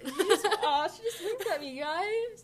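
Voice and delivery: high, giggly squeal to the listeners; laughing. Real, not acted. Reacting to a wink, laughing.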